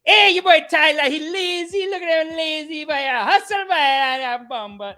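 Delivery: put-on high, loud West Indian accent yell; yelling West Indian coach. Coach yelling about lazy Tyler, loud West Indian accent. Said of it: "he's just yelling".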